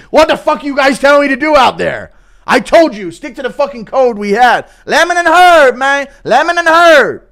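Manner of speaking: high, loud exasperated fighter shout; mock fighter yell. Fighter yelling at his corner between rounds. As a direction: yell at your corner, fed up.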